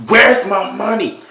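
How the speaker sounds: loud, high shouted question; angry demand. Retold or imitated. Candace beating Brandon with a pipe, angry shout. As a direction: shout it hard, each time as a demand.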